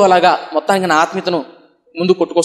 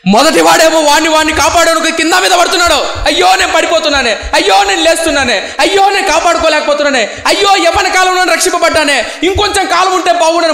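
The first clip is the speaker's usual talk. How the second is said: high wailing outcry; 11 semitones higher than usual. Retold or imitated. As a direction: cry out the lament at full force. Preacher mimics first youth wailing.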